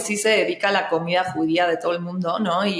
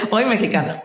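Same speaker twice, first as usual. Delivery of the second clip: laughing; 4 semitones higher, faster than usual. Real, not acted. Laughing answer.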